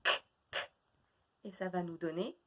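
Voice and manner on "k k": "K" as a voiceless click, twice; isolated sound. Shown as a demonstration. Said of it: "on enlève le".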